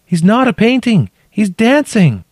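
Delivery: very high, loud insistent call; insist, calling from below. A narrator's reading. Trapped woman insists, calling up.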